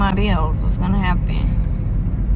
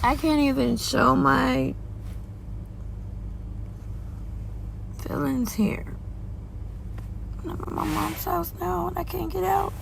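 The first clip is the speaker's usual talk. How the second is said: higher, softer, near tears; fighting back tears; 6 semitones higher, 8 dB quieter than usual. Real, not acted. Mother worries about her kids and bills, quietly near tears. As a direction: voice close to breaking while holding back crying. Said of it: "'cause I'm gonna cry".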